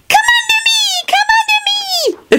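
tiny, extremely high creature voice; twittering, squeaky enchanted plant; happy squeal. A narrator's reading. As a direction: squeal it gleefully, stretching the last word. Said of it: "it squealed happily".

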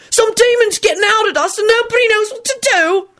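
very high-pitched agitated yell. A narrator's reading. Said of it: "Alex yelled".

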